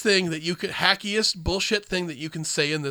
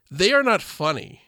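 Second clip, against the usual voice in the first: usual speaking voice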